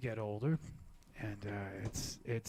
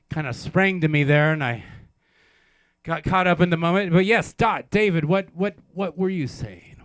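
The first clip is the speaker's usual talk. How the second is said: flustered loud recovery; 3 semitones higher, 9 dB louder than usual. Real, not acted.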